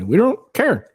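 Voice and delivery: squeaky high mock voice, dismissive. To his viewers, voicing guys in a high, mocking tone.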